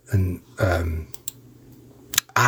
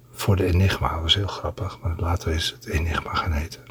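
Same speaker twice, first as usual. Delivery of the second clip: asmr whisper; 6 semitones lower, 3 dB quieter than usual. Acted. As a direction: lull them to sleep. ASMR reading to listeners.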